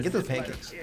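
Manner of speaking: usual speaking voice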